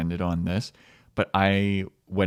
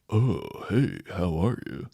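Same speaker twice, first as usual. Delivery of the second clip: laid-back dude voice; slower than usual. Retold or imitated. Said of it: "J P's was very".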